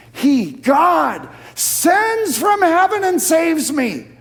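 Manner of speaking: high, loud, drawn-out proclamation; full-force preaching. Real, not acted. Preacher declares how God rescues him, full-force preaching. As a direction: preach it out triumphantly, stretching the key words.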